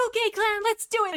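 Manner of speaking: high, peppy pep-talk voice; peppy rallying. Acted. Freckle Dapple rallying the clan for a workout, peppy.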